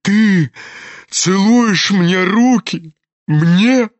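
slow, moved disbelief; astonished old general. A narrator's reading. General sees his son kissing his hands, astonished. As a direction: ask it haltingly, overcome with wonder.

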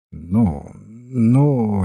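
low, impatient prompting. A narrator's reading. Detective urges the priest to continue, impatient. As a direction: cut in, urging the speaker on. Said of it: "нетерпеливо вставил сыщик".

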